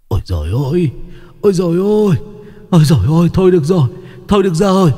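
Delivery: high, pained wailing plea; pleading ghost. A narrator's reading. Tortured one-eyed ghost begs and confesses, high wailing. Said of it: "nó giãy giụa van xin".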